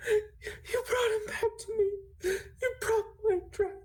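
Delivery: very high, gasping, tearful squeal; acted crying in a high-pitched voice; sobbing barmaid. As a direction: sob it out between gasps, overcome with relief. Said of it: "And she is c-, sobbing".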